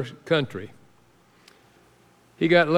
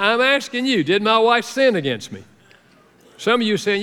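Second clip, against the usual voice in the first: ordinary talk, nothing marked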